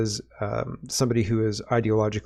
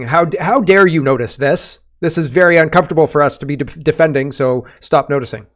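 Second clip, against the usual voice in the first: higher, louder, fast mock indignation; mocking outrage; 3 semitones higher, 6 dB louder than usual. Mocking critics who want the noticing stopped, sneering and fast. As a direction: parody the offended critics in a shrill rush. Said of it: "are, are saying".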